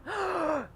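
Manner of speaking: sharp panicked gasp; gasp for air. Shown as a demonstration. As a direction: demonstrate a desperate inhale. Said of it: "«je dois récupérer de l'air»". (halètement paniqué)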